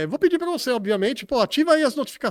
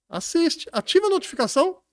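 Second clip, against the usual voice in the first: excited plea; 9 semitones higher than usual. Real, not acted. Asking viewers to turn on notifications.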